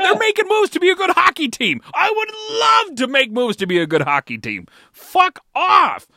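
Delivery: high, loud furious rant; angry yelling. Real, not acted. Fan ranting at rival team, angry yelling. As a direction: yell it out in disgust.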